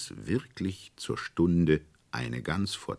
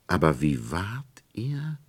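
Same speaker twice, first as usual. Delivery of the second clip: slow hushed whisper; 4 semitones higher than usual. A narrator's reading. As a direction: hush it with suspense. Suspense narration.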